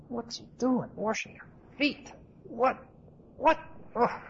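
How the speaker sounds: scoffing Peter. Retold or imitated. Peter voiced scoffing at washing.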